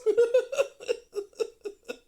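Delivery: high, loud burst of laughter; big high-pitched laugh. Real, not acted. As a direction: cackle at your own line. Big laugh at own joke.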